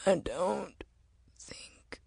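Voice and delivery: very soft, fading into breath; fading whisper. Acted. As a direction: let the words die away weakly. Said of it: "he whispered".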